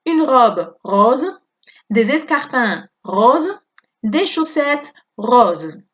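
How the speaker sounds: slow, clear teacher reading of each phrase; slow model reading. Shown as a demonstration. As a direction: read it slowly to be repeated. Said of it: "Todo suena igual". (lectura lenta de modelo)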